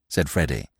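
ordinary delivery

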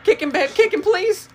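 very high, loud pleading yells; panicked shouting. Real, not acted. Begging babe to kick crawling enemy, panicked shouting. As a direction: beg frantically for help, shrieking.

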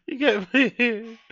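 high, slow words squeezed through laughter; giggling question; laughing. Real, not acted. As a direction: still cracking up as he asks. Laughing at own crude joke.

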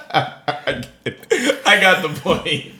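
breathless laugh, then slow amused words; laughing aloud. Real, not acted. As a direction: laugh him off good-naturedly. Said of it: "go ahead".

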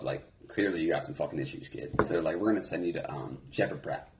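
low, soft imitated counselor; mild counselor talk. Counselors telling a kid he's being sent away, low and quiet. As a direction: hand down the decision quietly, pitched low.

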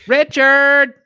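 high shouted call of Richard; mock yell. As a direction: yell the name out, high and loud. Friends yelling the name.

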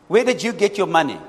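ordinary talk

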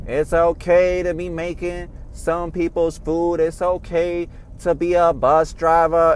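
slow mock imitation of a politician's put-on street accent; mocking a politician's fake accent, drawn out. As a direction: parody a politician pandering to a church crowd with a put-on accent.

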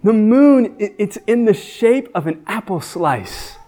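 put-on a small kid telling his dad; child's voice. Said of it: "He's like".

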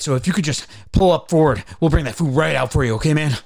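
fast, loud, jittery drive-thru guy; wired fast-talking cashier. Retold or imitated. Mimicking hyper drive-thru cashier, fast and wired. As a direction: rattle it off like he's on uppers. Imitating wired Wendy's worker.

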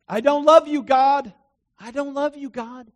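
loud, high proclaiming cry to God; proclaiming loudly. Retold or imitated. Preacher reenacting his loud cry to God. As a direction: belt it out like a worship confession.

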